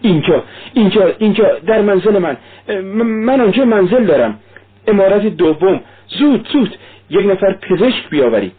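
high, urgent entreaty; acted frantic plea; pleading. A narrator's reading. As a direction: beg for help, pitched well up. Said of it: "سونیا تضرع کرد".

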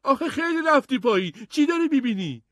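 put-on high, loud worried boy. A narrator's reading. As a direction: call down anxiously to your brother. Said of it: "ادموند نگران گفت".

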